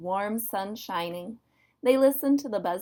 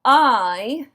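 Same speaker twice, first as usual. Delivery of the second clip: loud, drawn-out grumpy bear; bear's emphatic growl; 4 semitones higher, 10 dB louder than usual. A narrator's reading. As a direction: growl it out with force. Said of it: "he growled".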